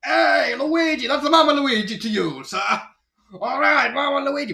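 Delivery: loud, high exaggerated Brooklyn accent. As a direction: shout it like cartoon Mario in Brooklyn. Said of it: "cartoon Mario with the exaggerated Brooklyn accent".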